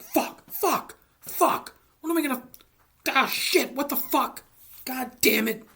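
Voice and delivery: high, loud frantic swearing; panicked cursing. Retold or imitated. DB Cooper falling from the plane, high loud panicked cursing. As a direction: curse yourself out in free fall, voice up high.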